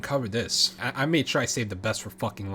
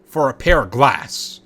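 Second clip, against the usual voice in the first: slow indignant; 7 dB louder, slower than usual. Real, not acted. Outrage at trade.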